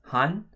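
Han read alone, clearly; Korean syllable. Shown as a demonstration. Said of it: "the first syllable would look like this".

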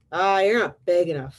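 loud, dismissive storybook brush-off; grouchy ladybug. Acted. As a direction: brush them off like a huffy bug. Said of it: "said the grouchy ladybug".

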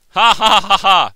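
loud, high, deliberate ha-ha cackle; hammed-up gloating laugh; mock villain laugh. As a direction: fake a slow, triumphant laugh. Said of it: "I left".

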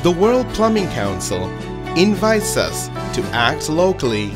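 ordinary talk, nothing marked

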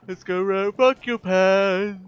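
high, loud, drawn-out holler; playful yelling. Pretend. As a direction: holler it playfully, stretching the words. Playful calling out while playing.

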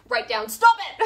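loud, high STOP IT; mock yelled order. Therapist ordering the patient to write it down, loud. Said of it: "he goes".

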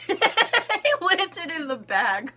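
slow giggly laugh. Real, not acted. Laughs at dropped candy.

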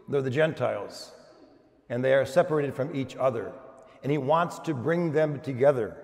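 usual speaking voice

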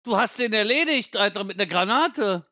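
excited. Real, not acted. Excited kill shout in game.

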